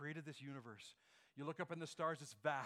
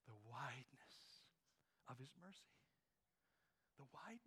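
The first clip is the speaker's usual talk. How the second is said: hushed refrain; 4 semitones lower, 14 dB quieter than usual. Real, not acted. Preacher closing on the refrain, fading to a whisper. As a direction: let the words fade to a whisper.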